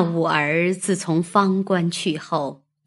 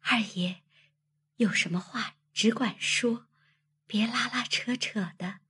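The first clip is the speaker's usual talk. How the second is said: hushed, higher, flustered maid; 悄悄地说; 4 semitones higher, 8 dB quieter than usual. A narrator's reading. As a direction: protest softly so nobody overhears. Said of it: "便悄悄说道". (慌乱地悄声说)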